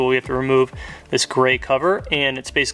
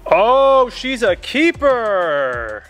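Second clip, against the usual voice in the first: high, loud Oh, then a slow drawn-out keeper; mock triumphant fisherman; excited exclaim; 8 semitones higher, 4 dB louder, much slower than usual. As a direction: crow over the catch like a proud angler. Mock fishing catch triumph.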